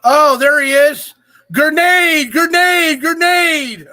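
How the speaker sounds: high, loud, drawn-out calling. Real, not acted. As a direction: bellow the name like a chant. Greeting a guest, calling out.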